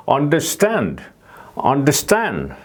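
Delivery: understand with STAND stressed; understand, level then landing on STAND; understand, punch STAND at the end; stress on last syllable. Shown as a demonstration. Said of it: "이렇게 발음이 되는 거죠".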